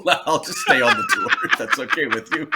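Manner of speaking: can't keep a straight face telling it; laughing. Real, not acted. Telling the host his reply to George, laughing through it.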